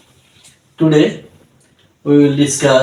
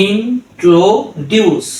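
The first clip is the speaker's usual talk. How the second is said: very slow blended word; 4 semitones higher, much slower than usual. Shown as a demonstration. Tutor blends the drilled syllables for learners, very slowly. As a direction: introduce stretched very slowly, syllables joined.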